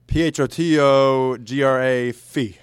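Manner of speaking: drawn-out goofy spelling; slow silly spelling. Pretend. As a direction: spell it out slowly, clowning.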